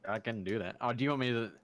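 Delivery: ordinary talk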